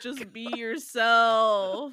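loud, slow, drawn-out plea; exasperated shout. Real, not acted. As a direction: stretch it out loudly in exasperation. Exasperated outburst at co-host.

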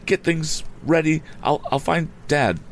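high-pitched, urgent youth bursting in with news; breathless-urgent report, pitched well up; urgent alarm. A narrator's reading. Boy tells the housekeeper of a motorcycle crash, urgent and high.

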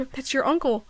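normal talking voice

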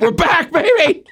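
high, thrilled shout; excited. Real, not acted. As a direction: cheer the comeback. Hosts return, celebrating team's win.

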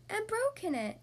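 high little bear-cub voice; small, high, childish cub; Baby Bear yelp. A narrator's reading. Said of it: "yelped Baby Bear".

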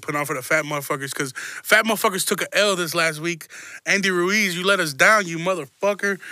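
usual speaking voice

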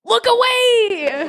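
slow, high mock-dramatic cry; hammed-up horrified friend; dramatic outcry. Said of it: "they're like".

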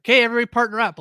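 teacher calling out. Retold or imitated. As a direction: call the class to pair up. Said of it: "it's like".

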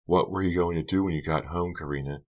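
plain reading voice

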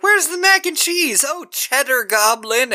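put-on high, loud excited kid voice. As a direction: play the eager kids at the table. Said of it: "these kids are like".

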